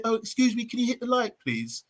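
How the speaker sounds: soft, dainty, overpolite request; overpolite Hollywood ask. Pretend. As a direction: ask sweetly like a Hollywood type. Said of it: "Like Hollywood".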